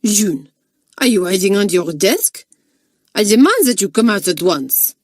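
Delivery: stern French mistress. Acted. Mistress demands June come out.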